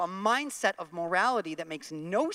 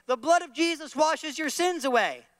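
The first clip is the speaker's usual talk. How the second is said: higher and louder, full pulpit voice; preacher proclamation; 8 semitones higher, 5 dB louder than usual. Pretend. Preacher shows what he can say in church, ringing preaching. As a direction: preach it like a revival line. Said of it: "I can say things like".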